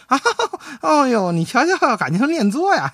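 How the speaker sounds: high giggly flattered delight; hammed-up tickled pleasure; delighted wife. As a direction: play the wife suddenly pleased, with a high little laugh. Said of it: "他媳妇儿高兴了".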